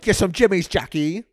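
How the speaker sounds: high, loud British caricature; hammed-up British accent; mock British. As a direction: shout the slang in mock British.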